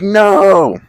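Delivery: very high, loud cry of dismay; anguished shout. Retold or imitated. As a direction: yell it as the weed hits the floor. Friend drops the weed.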